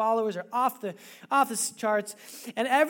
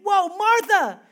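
calling a friend down from a rant; whoa, calm down; 8 semitones higher, 10 dB louder, much slower than usual. Retold or imitated. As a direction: rein her in with a loud whoa. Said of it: "he's probably saying".

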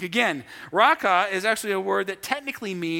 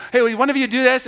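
put-on asking kids; 6 semitones higher, 7 dB louder, faster than usual. Shown as a demonstration. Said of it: "tone of voice".

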